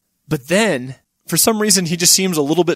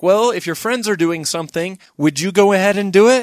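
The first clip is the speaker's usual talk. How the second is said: higher-pitched pointed questioning; reproving dog; 6 semitones higher, 4 dB louder than usual. Acted. Dog challenging Hitler's excuse, higher pointed question. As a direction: press the question like a chiding parent, pitched up. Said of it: "the dog is like".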